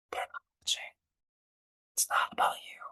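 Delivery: very soft whispered reassurance; intimate whisper. Pretend. Speaker advising the younger self, whispering. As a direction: whisper it like a secret.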